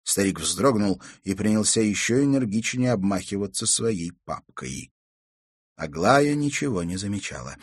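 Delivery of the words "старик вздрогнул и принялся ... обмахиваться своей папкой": plain reading voice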